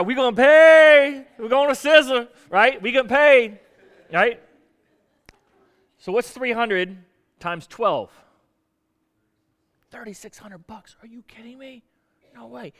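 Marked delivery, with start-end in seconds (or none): 0.0-4.4 excited workers. Acted. Workers imagining a bigger payout. 9.9-12.7 hushed, breathless whisper between workers; excited whisper. Acted. Workers whispering among themselves.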